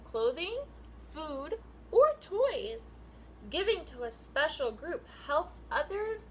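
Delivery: sing-song picture book read, rising and falling; reading to children. Acted. Teacher reading a rhyming book to her class, sing-song. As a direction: read the rhyme out to the class with lilt.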